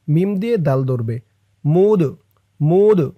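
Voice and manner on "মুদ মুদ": "মুদ" with a bouncing release on the final d; qalqalah push. Shown as a demonstration. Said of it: "দালটা কলকলার হরফ, ধাক্কা দিয়ে". ("মুদ"-এর দাল ধাক্কা দিয়ে)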